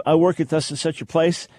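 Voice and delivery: normal talking voice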